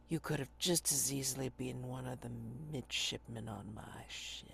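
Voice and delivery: put-on hushed, slow haunted old man; haunted old sailor. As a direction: whisper the tale like a ghost-struck sailor. GM voices haunted old lieutenant.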